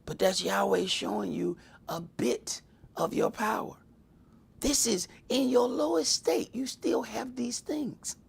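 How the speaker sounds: hushed, unvoiced sermon whisper; whispered revelation to the congregation. Real, not acted. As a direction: draw them in with a whisper. Sermon climax drops to whisper.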